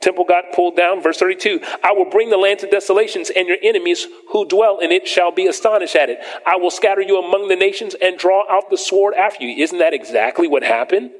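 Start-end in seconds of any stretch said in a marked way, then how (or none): 1.8-11.1 raised, declaiming Bible reading; preacher reading scripture aloud to the church. Acted. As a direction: proclaim the verses from the pulpit.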